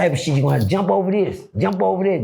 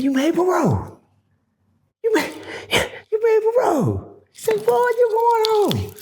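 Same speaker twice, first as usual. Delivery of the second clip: put-on old man crying; 14 semitones higher than usual. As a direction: let an old man's voice crack with tears.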